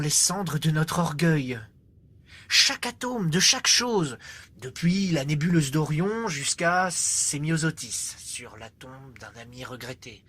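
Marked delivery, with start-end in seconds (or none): 2.5-4.2 higher, ringing emphasis on "Chaque" and "chaque"; "Chaque atome" launched high, as a climax; emphatic swell. Acted. Climax of the poem's list. 8.2-10.2 very soft, lowered voice; low, hushed mourning line; hushed aside. Acted.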